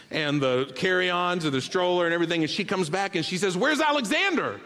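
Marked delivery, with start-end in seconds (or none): 3.6-4.6 high, startled mock panic; a wife's alarmed question about the missing baby. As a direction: sound suddenly frightened for the baby. Wife discovers baby missing.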